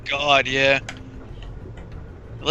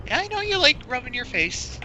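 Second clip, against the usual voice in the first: much higher pet voice; talking to cat; 11 semitones higher, 4 dB quieter than usual. Real, not acted. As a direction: coo to a cat pressed up against you. Speaks to the cat on them.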